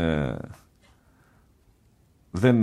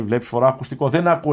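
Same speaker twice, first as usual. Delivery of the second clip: raised voice of someone who cannot hear; hard-of-hearing monk; 4 semitones higher, 4 dB louder than usual. Retold or imitated. Old monk telling the visitor about his hearing aid, raised voice. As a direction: imitate a deaf elder complaining, higher. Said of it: "μου κάνει έτσι το πτερίγιο του αυτιού του".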